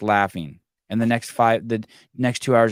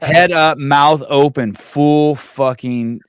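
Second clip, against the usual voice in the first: emphatic; 4 semitones higher, 8 dB louder, much slower than usual. Real, not acted. Describing a kid's bawl.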